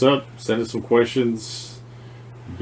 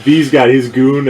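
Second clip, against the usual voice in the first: ordinary talk, nothing marked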